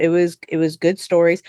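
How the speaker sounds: ordinary talk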